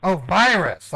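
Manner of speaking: "Oh, virus" with a proper V sound, surprised; sudden realisation. Real, not acted. Suddenly understands the word.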